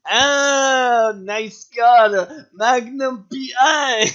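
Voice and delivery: put-on drawn-out Spanish accent, loud and high. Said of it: "in some Spanish accent".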